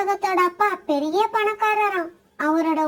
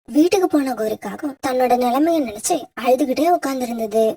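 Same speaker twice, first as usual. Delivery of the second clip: low, even storyteller's voice; story narration; 7 semitones lower than usual. A narrator's reading. Narrator telling listeners how the crow wept, low storyteller read. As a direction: narrate the sad fable steadily for listeners.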